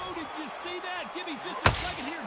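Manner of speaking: high, shocked outburst; surprised. Real, not acted. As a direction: gasp it out in disbelief. Commentator stunned by knockdown.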